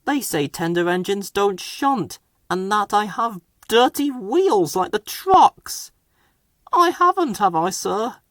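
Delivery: high, plaintive little engine; hurt Edward. A narrator's reading.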